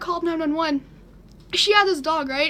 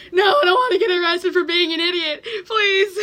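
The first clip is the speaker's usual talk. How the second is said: louder, higher, crying plea; tearful pleading; 4 semitones higher, 5 dB louder than usual. Retold or imitated. Teen begging the 911 operator, tearful. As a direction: beg through tears.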